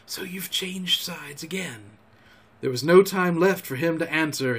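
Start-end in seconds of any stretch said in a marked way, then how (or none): 0.0-1.9 slow, breath-only whisper; hushed, drawn-out whispered reproach. A narrator's reading. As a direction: whisper it as a quiet accusation. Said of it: "not forbear whispering".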